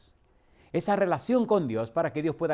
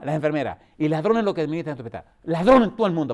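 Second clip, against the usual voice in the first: mock ranting old woman; 3 semitones higher, 6 dB louder than usual. Old patient ranting to her pastor about the thieves, loud and indignant. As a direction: rail against the thieving hospital.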